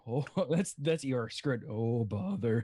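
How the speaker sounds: low, halting bumbling bear; lightly voiced low storybook bear; Pooh Bear. Player attempting the bear on request, low and halting. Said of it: "Pooh Bear".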